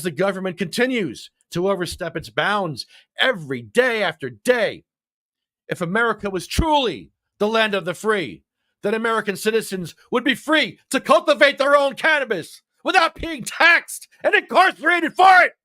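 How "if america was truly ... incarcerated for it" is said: rising from firm reading to a full high shout; building to a shout. Acted. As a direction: climb like a protest speech until you yell. Reading a comment with rising fervor.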